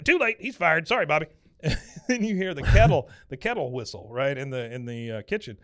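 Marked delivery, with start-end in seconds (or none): 0.0-1.3 high, loud mock-boss dismissal; blustering Buck. Buck brushing off Bobby's plea, loud mock bluster.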